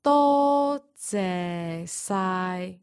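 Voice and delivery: very slow, drawn out; slow demo. Shown as a demonstration. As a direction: stretch every syllable. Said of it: "x0.4".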